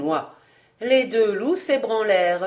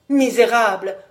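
loud outburst of terrified rage; frightened rage; 7 dB louder than usual. A narrator's reading. Condemned man insulting his judges in frightened rage. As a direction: spit the insult out of desperation. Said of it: "râla-t-il avec la rage que donne la frayeur".

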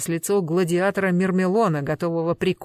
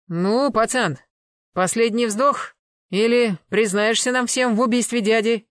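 loud, raised-pitch threat; menacing ultimatum; 5 semitones higher, 5 dB louder than usual. Acted. Enforcer demanding a confession with a last-breath warning, menacing ultimatum. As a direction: press him for a confession.

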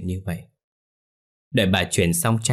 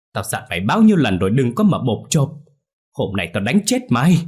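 high, loud shrieking rant at son; mother screams abuse at her careless son; furious scolding; 4 semitones higher, 4 dB louder than usual. Acted. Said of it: "rồi quát".

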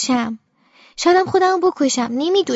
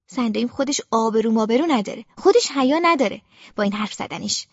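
ordinary delivery, nothing marked

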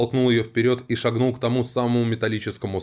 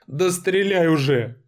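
high, slow desperate plea; begging; 8 semitones higher, slower than usual. A narrator's reading. Captive begs her torturer to shoot, high slow plea. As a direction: beg to be shot, pitched high and slow. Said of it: "взмолилась рыжая".

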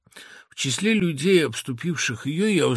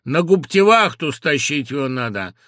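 soldier's order; 7 dB louder than usual. A narrator's reading.

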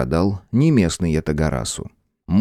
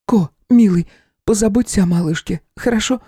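higher, tender woman's voice; tearful young woman; 12 semitones higher than usual. A narrator's reading. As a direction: say goodbye through tears. Tearful farewell to boy.

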